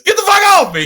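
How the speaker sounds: high, loud startled shout; furious yell. Retold or imitated. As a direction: bark him off you as you wake. Cousin wakes from seizure, before mouth-to-mouth.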